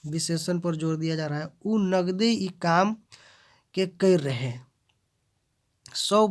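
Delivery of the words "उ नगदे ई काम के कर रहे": नगदे slowed and louder; stress on नगदे. Shown as a demonstration. Teacher showing adjective stress, नगदे drawn out. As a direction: linger on नगदे with weight. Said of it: "माने नगदे".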